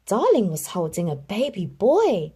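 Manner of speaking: plain reading voice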